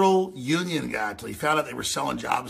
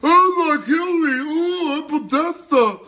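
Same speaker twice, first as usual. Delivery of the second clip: high, loud whining mockery; high loud mock cry; mocking whine; 12 semitones higher, 8 dB louder, slower than usual. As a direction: ham up a whimpering liberal. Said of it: "as long as he talks like this".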